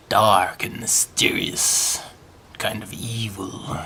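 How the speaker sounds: slow, hushed sinister whisper; spooky whisper. Pretend. Describing English villains, slow spooky whisper.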